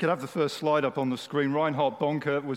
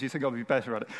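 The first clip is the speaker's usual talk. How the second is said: ordinary talk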